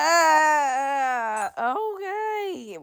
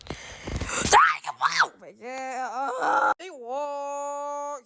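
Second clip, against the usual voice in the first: usual speaking voice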